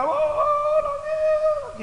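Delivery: very high mock wail of a hired mourner; high falsetto mock lament. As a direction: wail it like a professional mourner, hammed-up.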